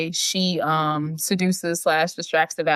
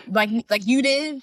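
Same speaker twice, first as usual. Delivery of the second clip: higher, mock-accusing question; pointed retort; 6 semitones higher than usual. Paige talks back to Max's worry, pointed retort. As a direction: throw it back at mother, acted out. Daughter's retort in retold quarrel.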